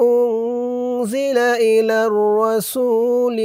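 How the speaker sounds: slow melodic tajweed chant; Quran recitation. Shown as a demonstration. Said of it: "teacher recites full verse". (কুরআন তিলাওয়াতের সুরে)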